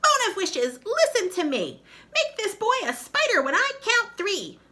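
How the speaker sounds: very high, loud incantation; shrill witch chanting a spell; squealing witch spell. Acted.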